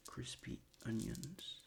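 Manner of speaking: normal talking voice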